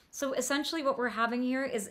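ordinary talk

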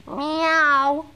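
high mock kitten meow; cat meow. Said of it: "does the wolf sound like this?".

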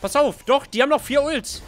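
loud high alarmed warning; panicking gamer. Real, not acted.

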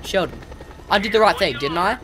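ordinary talk, nothing marked

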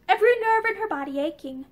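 ordinary delivery, nothing marked

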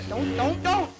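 alarmed yelling. Real, not acted. Gamer pleading with a creeper blocking them, alarmed yell. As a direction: yell to stop it, urgently.

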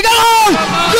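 goal shout. Real, not acted. Second commentator calls goal.

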